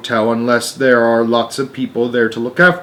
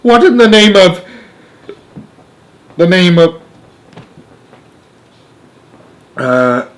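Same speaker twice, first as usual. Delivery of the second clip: astonished; 6 semitones higher, 6 dB louder than usual. Acted. Astronaut gapes at strange craft.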